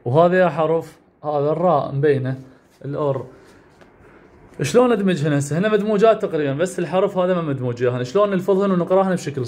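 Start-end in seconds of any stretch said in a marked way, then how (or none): none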